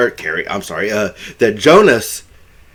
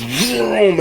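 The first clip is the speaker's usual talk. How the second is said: drawn-out, higher vroom; imitated engine vroom; 8 semitones higher, 4 dB quieter than usual. Retelling Bill speeding toward the tornado, imitated engine noise. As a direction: mimic a truck taking off.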